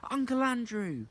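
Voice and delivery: put-on boy shouts the name as memory returns. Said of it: "he shouted out".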